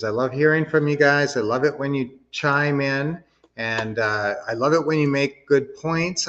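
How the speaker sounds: normal talking voice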